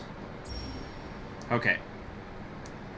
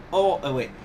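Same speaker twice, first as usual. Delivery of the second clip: ordinary talk, nothing marked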